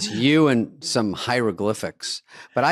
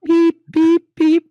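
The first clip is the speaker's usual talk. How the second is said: much higher, loud electronic beeps; sound-effect beeps; 7 dB louder, much slower than usual. Retold or imitated. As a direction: beep beep like a machine. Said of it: "then you hear this".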